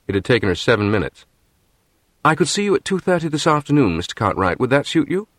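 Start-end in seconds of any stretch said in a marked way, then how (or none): 2.2-5.3 crisp English businesswoman's voice, lightly higher; English accent, composed and crisp. A narrator's reading. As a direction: keep the crisp English accent, cool and polite. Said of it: "in a crisp English accent".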